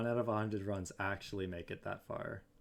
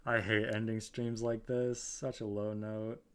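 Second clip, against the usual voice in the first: ordinary talk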